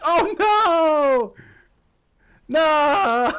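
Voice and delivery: high, loud cry of dismay; anguished shout. Real, not acted. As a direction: yell it as the goal goes in. Real dismay at losing goal.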